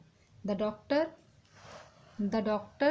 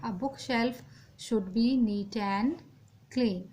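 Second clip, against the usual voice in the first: slow dictation; much slower than usual. Shown as a demonstration. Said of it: "इसमें हम book shelf करेंगे".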